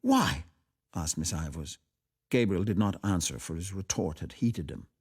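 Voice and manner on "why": insistent why. Acted. Miss Ivors insisting.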